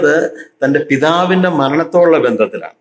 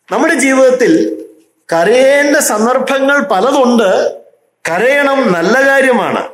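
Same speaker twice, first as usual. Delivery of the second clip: exhorting preaching; 7 semitones higher, slower than usual. Acted.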